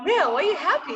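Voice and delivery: amused question. Retold or imitated. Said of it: "I'm like".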